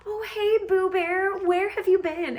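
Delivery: loud, very high, hammed-up greeting; gushing mock girlfriend. Heroine playing along as his girlfriend, gushing. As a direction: squeal a sweetheart's pet name for show.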